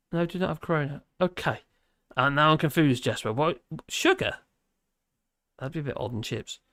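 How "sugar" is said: puzzled surprise. Pretend. Baffled by chat comment.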